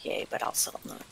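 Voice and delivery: very quiet, half-whispered murmur; muttering to self. Real, not acted. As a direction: think aloud under your breath. Muttering to self over game choices.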